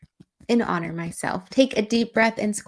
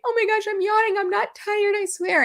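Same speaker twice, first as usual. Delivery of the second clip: much higher, mock-flustered protest; imitating a yawner; 13 semitones higher than usual. Coach mimics people apologizing for yawning, high and flustered. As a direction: squeak it out like someone embarrassed to yawn. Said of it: "sometimes people are like".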